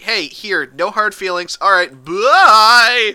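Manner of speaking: hammed-up cheery good guy. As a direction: sound like a nice boy, building to a long loud goodbye. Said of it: "good guy voice he does".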